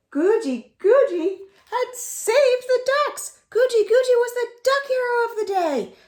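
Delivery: high, loud, fast cheer; triumphant. A narrator's reading. Proclaiming Guji Guji the ducks' hero, high and loud. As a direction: announce the hero with joy.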